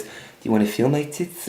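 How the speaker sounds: soft, mock airhead come-on; Tanya. Airhead propositioning him in the car, softly imitated. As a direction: imitate a dumb club girl, hushed. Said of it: "she talked like this".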